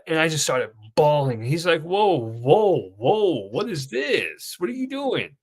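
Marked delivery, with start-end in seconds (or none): 1.8-5.4 drawn-out whoas climbing to high pitch; taken aback. Retold or imitated. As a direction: imitate startled concern at sudden tears. Supervisor reacts to sudden bawling.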